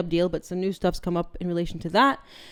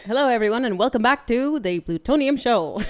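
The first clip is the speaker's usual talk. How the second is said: lightly put-on transatlantic accent, raised pitch; old Hollywood transatlantic accent, higher; 5 semitones higher, 4 dB louder than usual. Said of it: "can you do it in the transatlantic accent?".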